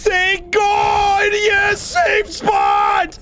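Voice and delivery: very high drawn-out shout of relief; shrieking with relief; relieved screaming. Real, not acted. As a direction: cry out in ecstatic relief. Relief at finding safe spot.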